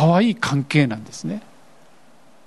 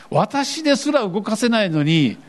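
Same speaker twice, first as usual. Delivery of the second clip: ordinary talk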